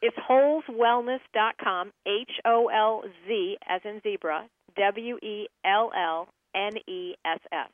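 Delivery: slow, spelled-out web address; measured letter-by-letter dictation; clear repeat. Real, not acted. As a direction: repeat it slowly so everybody can get it. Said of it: "one more time so everybody gets it".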